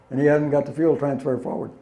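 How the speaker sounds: ordinary delivery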